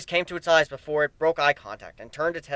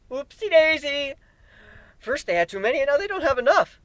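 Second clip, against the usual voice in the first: unhinged cheer over dead beasts; childish oopsie; 11 semitones higher than usual. Acted. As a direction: coo it like a toddler's mistake. Manic childlike voice.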